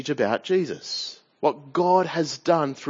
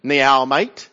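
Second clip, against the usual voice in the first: put-on Mandarin "Ni hao" in a broad Aussie accent, louder; 2 semitones lower, 6 dB louder than usual. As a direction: greet in Chinese the Australian way, a little louder. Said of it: "Mandarin with an Aussie accent".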